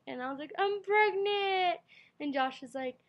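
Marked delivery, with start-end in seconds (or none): 0.6-1.8 high, loud and slow, voice breaking into tears; retold tearful cry, loud and high. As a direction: wail it out through sobs, much higher. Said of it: "and then I just start crying".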